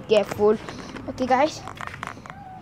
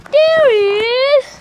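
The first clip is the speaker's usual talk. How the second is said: high, loud, drawn-out cry of discovery; excited discovery; 10 semitones higher, 6 dB louder, slower than usual. Real, not acted. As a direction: squeal it out slowly on finding the cat. Spots the lost cat.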